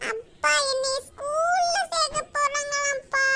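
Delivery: slow, high tearful complaint to father; little girl's sobbing tattle; crying child. Acted. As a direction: wail it out, stretching the vowels. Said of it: "இப்படி அழுதுகிட்டு".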